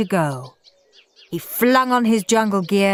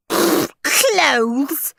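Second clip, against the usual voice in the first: jeer; 5 semitones higher, slower than usual. A narrator's reading. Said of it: "jeered Henry".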